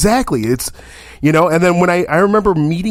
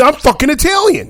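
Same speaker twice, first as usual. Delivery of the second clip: flipping out; 11 semitones higher than usual. Acted. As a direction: protest your identity, acting out an offended outburst. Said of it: "They're gonna flip out".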